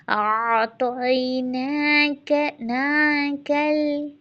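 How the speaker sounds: slow recited ना कल; tajweed demo. Shown as a demonstration. Teacher models light lam in ना कल. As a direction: recite ना कल slowly, lam kept central. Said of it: "लाम middle में रहते हुए".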